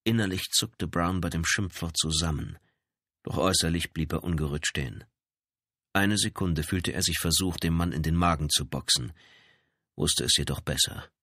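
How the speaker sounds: plain reading voice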